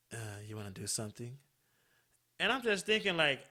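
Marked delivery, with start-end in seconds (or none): 0.1-1.4 low, soft, quick suggestive ask; imitating his different tone. Caller proposing something to her, imitated low and soft. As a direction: slip it in low and quiet, as him. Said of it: "he sounds a bit different. His tone is a bit different".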